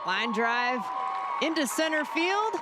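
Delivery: announcer calling a line drive; excited call. Real, not acted. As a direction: call the hit with rising excitement. Live play-by-play call.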